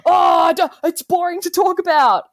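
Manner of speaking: high, loud, drawn-out mock of the date. Mimicking her dull date's brush-off, high and loud. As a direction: sneer it out like a bored snob. Imitating date's dismissive reply.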